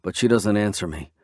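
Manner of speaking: ordinary delivery, nothing marked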